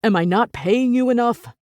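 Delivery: sharp, loud accusation; accusing man. A narrator's reading. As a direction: fire it at her. Jasper accusing Viola.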